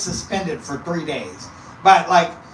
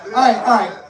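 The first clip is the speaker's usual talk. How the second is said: usual speaking voice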